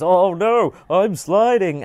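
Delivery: high, loud hammed-up alarm; mock panic. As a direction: ham up sliding helplessly. Mock panic acting out game slide.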